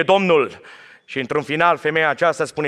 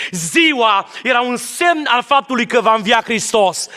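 preached shout; 6 semitones higher than usual. Acted. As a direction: cry it out slowly to the hall.

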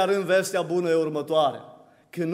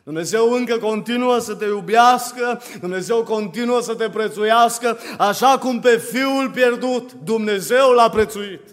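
forceful proclamation, slowing at the end; impassioned preaching; 5 semitones higher, 7 dB louder than usual. Real, not acted. Preacher assures the congregation God still loves them, impassioned preaching. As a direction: proclaim it phrase by phrase.

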